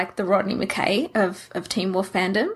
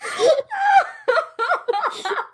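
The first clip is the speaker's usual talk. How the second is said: high, giggling tease through laughter; laughing; faster than usual. Real, not acted. Friend laughing at the co-host's name mix-up. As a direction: laugh while teasing the slip.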